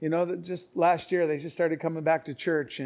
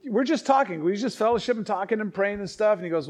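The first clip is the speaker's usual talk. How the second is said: ordinary talk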